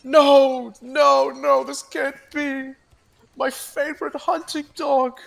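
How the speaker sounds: high, pained wail of a heartbroken man; grieving Alden. Acted. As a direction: wail over the dead hunting dog. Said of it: "Alden says".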